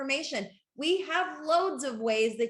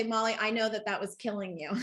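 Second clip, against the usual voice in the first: ordinary delivery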